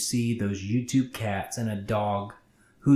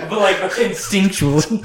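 high, loud, amused protest; laughing explanation; 8 semitones higher, 7 dB louder, faster than usual. Real, not acted. Reader excusing the voice slip, laughing.

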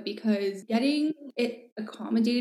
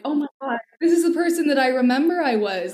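higher, louder burst of recognition; elated relief; 5 semitones higher, 5 dB louder than usual. Retold or imitated. Patient finding herself again on new meds, elated relief. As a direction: let the delighted realization lift the voice.

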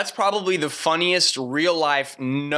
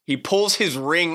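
normal talking voice

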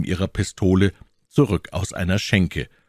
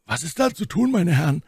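asking the friends; 11 semitones higher than usual. A narrator's reading. Athos asks his friends.

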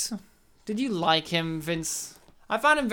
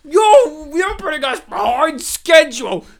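high, loud, exasperated yelling at a late employee; furious boss shout; 6 semitones higher, 6 dB louder than usual. Retold or imitated. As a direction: bellow at him to get moving, higher and louder. Elephant boss yells at Bill.

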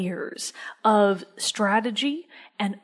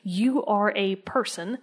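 ordinary delivery

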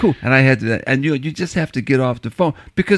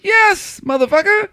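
emphatic yes; slower than usual. Retold or imitated. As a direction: hit it high and hard. Insists he got vaccinated.

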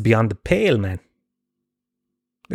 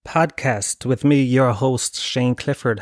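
slow, higher announcer welcome; podcast host intro; 4 semitones higher, much slower than usual. Acted. Opening his podcast to listeners, slow and announcer-like. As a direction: greet listeners like a show host.

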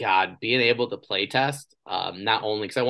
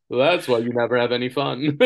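louder, mocking inner voice; snarky inner voice; 4 semitones higher, 7 dB louder than usual. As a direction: sneer it like the voice in your head. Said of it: "The voice literally sounds like that".

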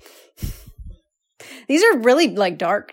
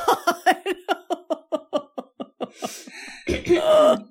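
high-pitched burst of laughing; high laughter; 6 semitones higher than usual. Real, not acted. Reacts to a dark joke.

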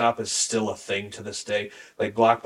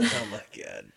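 exasperated groan; 13 semitones lower, 6 dB quieter than usual. Real, not acted. Reacting to the mock brag.

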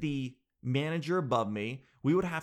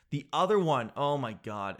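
ordinary talk, nothing marked

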